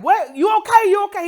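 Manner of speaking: high, loud panicked calls to his wife; husband hollering in alarm as she shakes. Retold or imitated. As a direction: shout it in sudden fear. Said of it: "He was just hollering".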